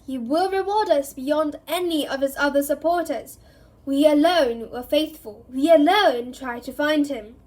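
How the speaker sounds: loud, high, triumphant defiance; defiant fanatic cry. Acted. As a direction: proclaim your loyalty to the Dark Lord. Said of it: "and called".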